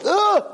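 high yelp of revulsion; hammed-up disgusted cry; disgusted groan. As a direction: react to the bitter gulp with a high "ugh". Prince drinks bitter potion again.

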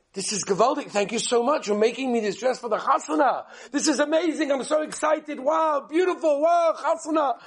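high, breathless-style mock gushing; gushing excitement. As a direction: imitate an overjoyed bride thanking her parent. Daughter thrilled by supposed wedding dress.

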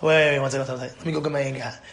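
low, softened holding-off; subdued, muttered stalling; restrained pause. Acted. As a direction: drop the voice and hold the temper back. Acts pausing calmly before blowing up.

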